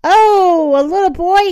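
high, loud gloating fairy; grinning bad fairy. A narrator's reading. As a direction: pounce on it with a wicked grin. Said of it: "she grinned".

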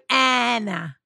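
"Anna" as ANN-uh, drawn out; "Anna", firmly corrected to ANN-uh; Anna with short A. Shown as a demonstration. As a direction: lean on the cat vowel in "Anna". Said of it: "Uh, A-, sorry".

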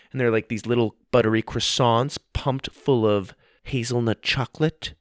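plain reading voice

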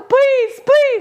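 high, whiny imitation of begging; mock tearful pleading. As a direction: beg in an exaggerated high wail. Mimics sick roommate begging for help.

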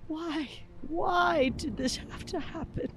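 high, wailing sobbed pleas; uncontrollable sobbing. Acted. Mick alone, pleading for it to end, sobbing uncontrollably. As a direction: beg through sobs, pitch far up. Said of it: "uncontrollably starts to sob".

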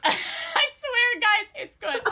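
high-pitched, talking through real laughter; helpless laughter. Real, not acted. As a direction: try to get the words out while cracking up. Cracks up saying the series title.

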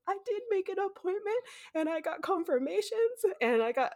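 high, tearful pleading; crying. Retold or imitated. Telling the receptionist she booked, in tears. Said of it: "and I start crying".

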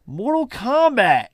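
high, drawn-out game-announcer yell; theatrical title shout. Acted. Podcaster breaking from the recap to yell the game's title, theatrical shout. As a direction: belt out the title, stretched and high.

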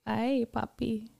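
put-on slow, drawn-out ay, papi in a Mexican accent; playful Mexican accent tag-on. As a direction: purr it like a flirty aside in a Mexican accent. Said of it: "I like the ay, papi".

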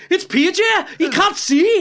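high, exclaimed Geordie catchphrase; Geordie accent. Shown as a demonstration. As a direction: cry it out in hammed-up Geordie. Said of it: "you need a phrase to get you in there".

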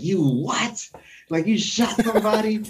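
high, incredulous squad leader; imitating squad leader. Squad leader hears his soldier shot someone, high and incredulous. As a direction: play the sergeant who can't believe it. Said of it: "He's like".

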